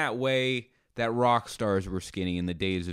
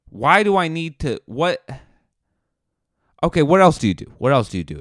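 exasperated demand; 6 semitones higher, 7 dB louder, faster than usual. Retold or imitated. Frustrated questioning Itchy.

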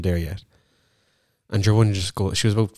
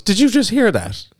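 high, louder amazed question; excited disbelief; 13 semitones higher, 5 dB louder than usual. Retold or imitated. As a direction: ask it in delighted disbelief. Retelling own reaction to staff.

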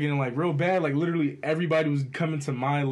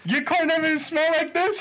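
high girlish mock voice; her excuse; 10 semitones higher than usual. Imitating the passenger blaming his car, high pitched. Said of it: "She was like".

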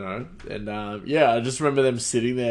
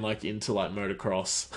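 ordinary delivery

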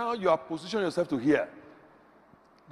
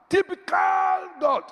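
slow, much higher mock shout of disgust; sarcastic outburst; 13 semitones higher, 5 dB louder, much slower than usual. Preacher impersonating a believer scolding God, slow high mock shout. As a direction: throw it at God like a fed-up complainer.

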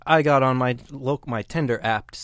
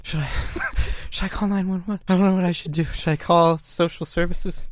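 imitating a nervous roommate; higher, worried roommate voice; 6 semitones higher than usual. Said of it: "My new roommate's right now being like".

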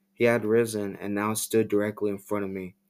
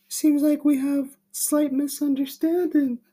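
much higher, eerie put-on voice; creepy Tommy voice; 4 dB louder than usual. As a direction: play him as an unsettling smiling stranger. Creepy stranger Tommy speaks.

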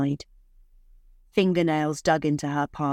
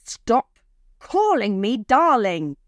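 slow, raised angry yell; 5 semitones higher, 5 dB louder, slower than usual. A narrator's reading. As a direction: yell it slowly, higher and louder. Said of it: "he yelled".

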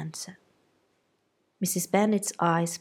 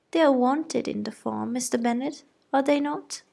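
high-pitched insistent mother; wife seeking husband's backing; fussy mother; 7 semitones higher, 3 dB louder than usual. A narrator's reading. Mrs Bennet asks husband.

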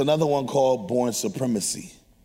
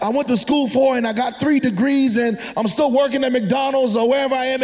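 raised, ringing preacher voice; preaching; 7 semitones higher than usual. Acted. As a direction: preach it out to the church. Preacher builds to a shout.

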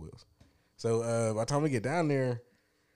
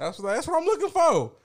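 eager relief; 5 dB louder than usual. Retold or imitated. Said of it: "And I was like".

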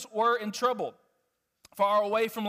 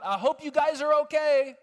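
louder, higher casual call from the shore; higher, louder mock shout of halfhearted concern; 5 semitones higher, 5 dB louder than usual. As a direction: yell it offhandedly across the water. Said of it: "he doesn't just yell out".

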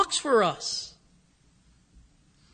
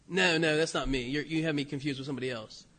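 low, quiet brush-off; soft, lowered protest of mistaken identity; low denial; 8 semitones lower, 8 dB quieter, faster than usual. Retold or imitated. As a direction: deflect suspicion, keeping the voice down. Peter voiced denying the accusation.

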